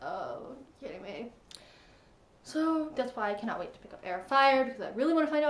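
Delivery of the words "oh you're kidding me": softer, lower oh you're kidding me; muttered disbelief. Retold or imitated. Fan dismayed at the king's order, hushed. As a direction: let it drop in disbelief.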